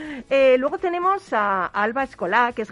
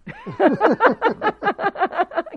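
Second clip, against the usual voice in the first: loud laugh; 10 semitones higher, 6 dB louder than usual. Real, not acted. Laughs at own joke.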